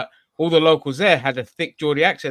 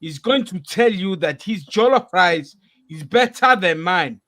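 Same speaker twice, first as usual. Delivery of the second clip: put-on high, loud indignant Nigerian accent; 7 semitones higher, 8 dB louder than usual. Friends trade Nigerian accents, jollof boast in Nigerian accent. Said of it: "That's Nigerian".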